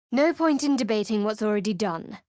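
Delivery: normal talking voice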